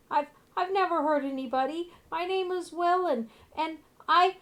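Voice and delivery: put-on high, loud pleading little boy voice; ghost boy. Ghost boy pleading to the party for his bear. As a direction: beg like a frightened child ghost.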